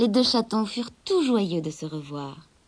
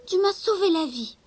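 grateful kitten; 9 semitones higher than usual. Acted. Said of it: "lui dit alors Gobolino".

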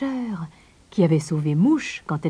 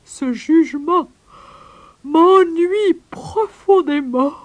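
slow, high, yawning drawl; hammed-up boredom, very slow and stretched; bored sleepy koala; 9 semitones higher, 7 dB louder, slower than usual. A narrator's reading. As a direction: drag it out loudly through a yawn. Bored koala about to fall asleep.

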